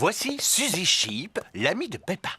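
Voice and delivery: story narration. A narrator's reading. Narrator presenting Suzy Sheep to the audience. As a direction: introduce the new friend warmly.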